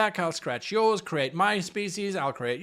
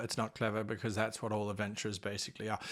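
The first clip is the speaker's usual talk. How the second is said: quiet aside; 11 semitones lower, 14 dB quieter, faster than usual. Real, not acted. Aside undercutting the praise.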